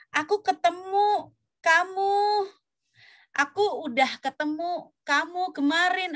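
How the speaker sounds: high-pitched, slow and measured mock formality; overly formal speech. Teacher imitating someone talking too formally, slow formal speech. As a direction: spell it out stiffly, word by word. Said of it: "yang ngomong seformal itu".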